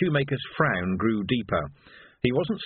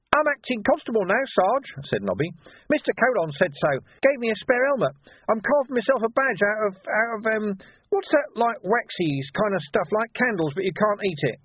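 much higher, chirpy scrounger voice; Nobby boasting to his sergeant; cheeky urchin Nobby; 11 semitones higher than usual. Acted. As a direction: rattle on like a pleased little rogue. Nobby chattering.

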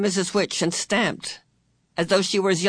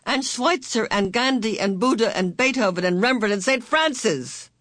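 ringing with confidence; 6 semitones higher than usual. A narrator's reading. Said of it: "Calvin's voice rang with confidence".

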